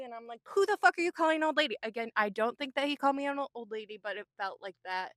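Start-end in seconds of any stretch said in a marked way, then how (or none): 0.6-1.8 fast, high retort of outrage; retold affront, rushed and pitched up; indignant comeback. Retelling her comeback to donation worker, indignant.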